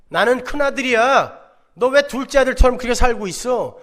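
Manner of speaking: elder son scolding his younger brother, indignant and loud. Retold or imitated. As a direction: play the offended elder brother.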